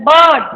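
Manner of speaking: बर्ड with long uh and no r; silent r. Shown as a demonstration. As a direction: stretch the vowel of बर्ड, skipping the r. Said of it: "र नहीं बोलना बीच में". (बर्ड में लंबा अ, र के बिना)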